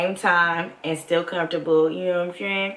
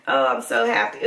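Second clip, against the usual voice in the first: ordinary talk, nothing marked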